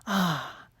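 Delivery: a quick breathy gasp of wonder; surprised gasp. A narrator's reading. Mermaid surfacing into cold air, surprised gasp. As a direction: gasp as you break the surface. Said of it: "she exclaimed".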